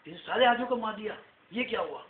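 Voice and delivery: quick, high astonished outburst. Acted. Townsfolk reacting to the dead elephants, astonished. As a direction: blurt it out in disbelief.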